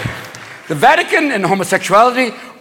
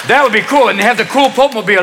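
ordinary delivery

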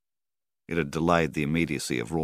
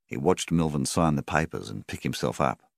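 ordinary delivery, nothing marked

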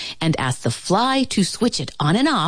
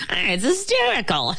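high, giggly fly voice; laughing fly; 6 semitones higher than usual. A narrator's reading. Fly cracking up at the bulb joke, laughing. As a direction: squeeze the words out through a giggle. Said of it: "The fly began to laugh".

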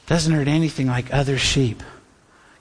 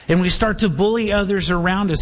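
ordinary talk, nothing marked

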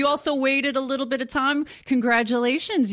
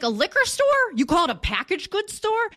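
incredulous; 8 semitones higher than usual. Retold or imitated. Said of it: "I'm like".

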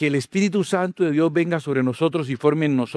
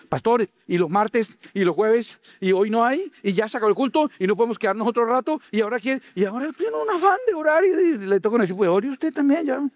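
high, pleading voice of a suddenly devout member; mock desperate churchgoer; 7 semitones higher than usual. Churchgoer begging the pastor for more services, high pleading. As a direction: beg the pastor to stay longer, voice pitched up. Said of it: "usted la va a ver diciendo".

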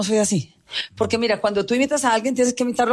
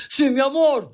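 higher, put-on imitation of herself; mocking imitation; 5 semitones higher than usual. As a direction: mimic an impersonator's exaggerated sweet talk. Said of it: "yo no hablo así".

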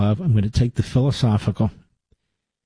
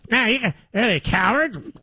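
mock heckler; 9 semitones higher than usual. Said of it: "mocking a heckling podcaster".

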